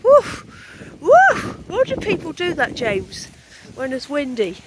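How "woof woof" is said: high, loud playful woof into the wind; mock dog bark. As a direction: bark like a silly dog for fun. Barking into the wind.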